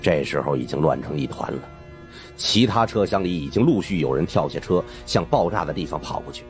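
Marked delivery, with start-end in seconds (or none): none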